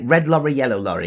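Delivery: "Red lorry, yellow lorry" said crisply high; tongue twister. Shown as a demonstration. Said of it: "just say".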